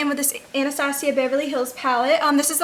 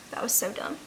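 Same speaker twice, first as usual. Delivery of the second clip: quiet mutter; 5 semitones lower, 11 dB quieter than usual. Real, not acted. Embarrassed remark to herself.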